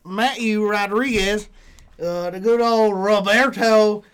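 put-on host the show like a good ol' country boy; country accent. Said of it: "every week you come up with a, with a different accent".

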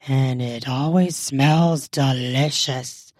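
low, slow husband's voice; complimenting husband. A narrator's reading. Husband compliments wife on dinner's smell, low and slow. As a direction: drop low and draw out the compliment. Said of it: "as he complimented".

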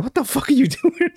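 high, laughing what-the-hell; mock horrified squeal; shocked disbelief. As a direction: gasp out the question, cracking up. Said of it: "I'm like".

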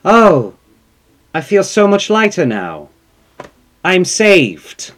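hammed-up relief of a young man; relieved son. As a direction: float out light as a feather. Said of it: "he steps out and he goes".

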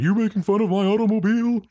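high-pitched cartoon character voice; high comic impression of a cartoon guy; Simpsons character impression. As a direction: put on a high, cartoonish accent. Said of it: "the guy from Simpsons".

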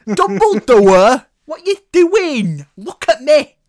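high, loud, drawn-out mock outrage; over-the-top imitated yell; hammed-up shouting. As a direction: bellow it slowly like a wronged teen demanding attention.

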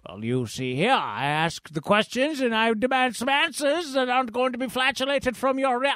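a high, haughty put-on voice; pompous high voice. Pompous character taunting his rival, high and haughty. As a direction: lord it over him, sneering. Pompous figure demands answers.

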